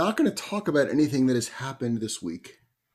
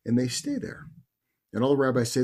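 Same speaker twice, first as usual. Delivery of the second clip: ordinary delivery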